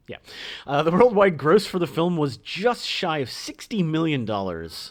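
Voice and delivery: ordinary delivery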